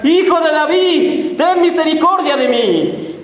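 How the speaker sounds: high, slow drawn-out outcry; desperate cry. Retold or imitated. Bartimeo shouting to Jesus, slow high outcry. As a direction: stretch the plea out to reach him. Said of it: "Bartimeo empieza a gritar".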